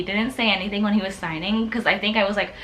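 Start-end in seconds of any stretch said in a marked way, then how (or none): none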